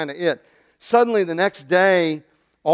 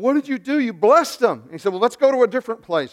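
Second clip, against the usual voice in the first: retold outburst, higher and a bit louder; mock angry king; 7 semitones higher, 5 dB louder than usual. Balak scolding Balaam for blessing Israel, mock anger. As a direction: play the ticked-off king. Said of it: "Balak is ticked off".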